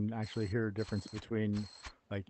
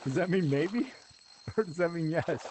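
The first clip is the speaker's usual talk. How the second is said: amused confusion; 6 semitones higher than usual. Retold or imitated. Acts out his confusion at phrase.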